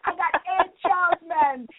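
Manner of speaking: comic In Living Color Jamaican voice; Jamaican accent. Retold or imitated. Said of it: "don't laugh at my Jamaican accent".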